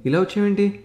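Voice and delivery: surprised, bright welcome from a college friend; Durga's cheerful greeting. Acted. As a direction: greet an old friend with delight. Durga greets Mahesh warmly.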